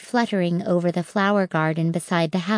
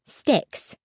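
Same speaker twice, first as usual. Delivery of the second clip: ordinary delivery, nothing marked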